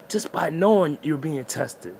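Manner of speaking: ordinary talk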